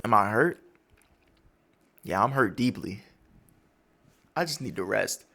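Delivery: low, soft, worn-out sigh of a voice; weary old admiral. Retold or imitated. Kizaru answering his soldiers, low and drained, done with it all. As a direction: sound too tired to lift the words.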